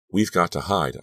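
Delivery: ordinary delivery, nothing marked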